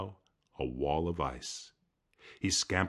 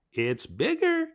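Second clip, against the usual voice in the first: Coyote thinking. Acted. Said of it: "he thought".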